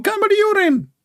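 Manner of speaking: very high, piping joke voice; high falsetto gag voice; squeaky mock answer. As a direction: squeal the punchline in a silly voice.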